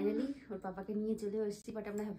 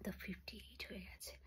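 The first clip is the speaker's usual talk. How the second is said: secret hushed whisper; 11 dB quieter than usual. Real, not acted. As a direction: whisper so dad stays asleep. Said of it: "আমরা চুরি করে কথা বলছি".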